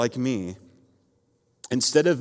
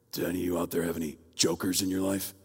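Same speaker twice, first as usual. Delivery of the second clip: low, hushed vigilante voice; Batman; 6 semitones lower, 3 dB quieter, faster than usual. Acted. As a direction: ask it low and quick, as the masked hero. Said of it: "I'd try to talk like Batman".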